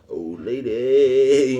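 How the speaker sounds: put-on very slow, stretched "Old lady"; drawn-out repeat. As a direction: drag out "lady" like savoring it. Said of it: "what you say, bruh?".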